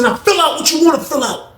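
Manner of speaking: high-pitched retold generosity; showy offer. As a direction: act out a grand, showy invitation. [name] shows off the sheet.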